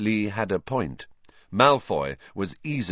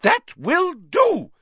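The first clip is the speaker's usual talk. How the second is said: very high, slow, loud shriek; 5 dB louder, slower than usual. A narrator's reading. Madam Hooch separating the players, loud shriek. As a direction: stop the fight with a shriek. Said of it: "shrieked Madam Hooch".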